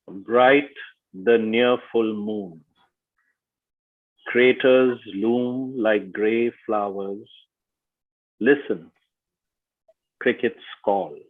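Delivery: slow, weighted poem reading with long pauses; measured verse, line by line; metered haiku reading. Shown as a demonstration. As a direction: give each syllable its weight and meaning. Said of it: "I'm gonna read the whole thing with its meter, with its syllabic weight, and its meaning".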